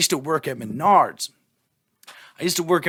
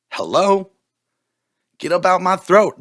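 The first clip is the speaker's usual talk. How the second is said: louder, higher, fed-up shout at his own throat; yelling at heartburn; 4 semitones higher, 5 dB louder than usual. Real, not acted. A man yelling at his own heartburn, fed-up shout. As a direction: bark it at the burning in your chest.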